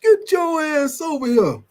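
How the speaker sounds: high mock coach call; imitating a coach calling a player over. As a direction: summon a player off the field like a coach. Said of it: "your coach be done call".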